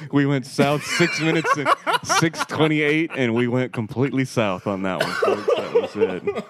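helpless laughing delivery; laughing while talking. Real, not acted. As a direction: announce it while cracking up. Cracking up over the derailment.

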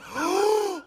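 high, startled mock gasp; sharp mock gasp. Teller gasps at his own story in mock shock. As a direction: suck the air in with a fake shock. Gasp after a confession setup.

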